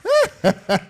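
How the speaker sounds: high burst of laughter; delighted laugh after singing. Real, not acted. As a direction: crack up after the song.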